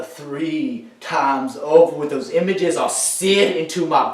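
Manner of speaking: building to a high, anguished shout; anguished outburst. Acted. Veteran confessing he killed people, rising to anguished shouting. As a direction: let guilt climb until you are shouting.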